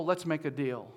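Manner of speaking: normal talking voice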